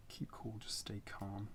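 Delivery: soft unvoiced whisper, slow. Real, not acted. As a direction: murmur it under your breath. Card names whispered to self.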